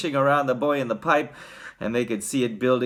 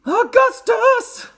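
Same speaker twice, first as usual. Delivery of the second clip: very high, drawn-out motherly call; loud, slow, piercingly high shout; mother calling her son to heel; 8 dB louder, slower than usual. A narrator's reading. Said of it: "called out Missus Gloop".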